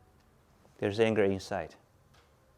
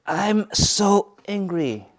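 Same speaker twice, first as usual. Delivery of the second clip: mock anger; 9 semitones higher, much slower than usual. Shown as a demonstration. Acting out an angry person.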